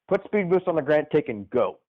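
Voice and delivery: ordinary talk, nothing marked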